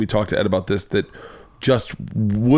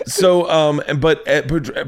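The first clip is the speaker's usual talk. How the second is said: ordinary talk, nothing marked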